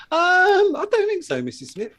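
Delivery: high, loud fake-sweet reply; mock polite. Postman answering the customer in a high, loud, sugary voice. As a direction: put on a falsely polite voice for her.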